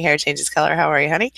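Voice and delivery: usual speaking voice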